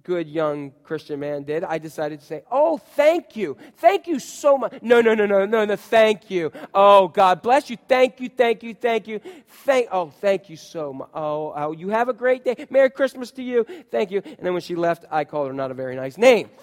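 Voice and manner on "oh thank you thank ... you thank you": loud, effusive mock gratitude; over-the-top sarcastic thanks. Driver answering a yelling lady with thanks, sarcastically gushing. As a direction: gush thanks relentlessly, hammed up and insincere.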